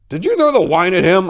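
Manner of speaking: loud, high screamed question; screaming. A narrator's reading. Narrator yelling at the cousin over the flames, screaming. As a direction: scream it across the burning room. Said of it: "I screamed".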